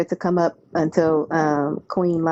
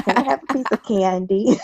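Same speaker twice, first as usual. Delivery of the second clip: imitating grandson; 6 semitones higher than usual. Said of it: "he says candy".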